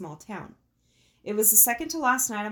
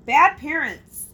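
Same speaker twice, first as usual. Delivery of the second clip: emphatic; 6 semitones higher, 10 dB louder, slower than usual. Real, not acted. Judging the mom's reaction.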